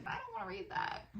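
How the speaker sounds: high, soft mock-dismissive voice; dismissive quote. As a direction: brush it off like a picky kid. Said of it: "but I was like".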